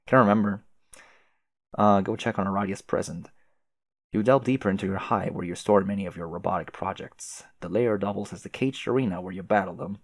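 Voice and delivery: usual speaking voice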